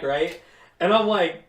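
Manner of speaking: ordinary delivery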